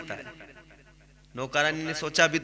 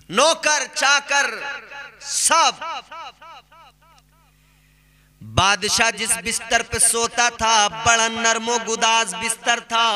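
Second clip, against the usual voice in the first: slow, raised sermon delivery; preaching storyteller; 6 semitones higher, 4 dB louder, slower than usual. Acted. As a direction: build the tale like a preacher from the pulpit. Preacher tells story with force.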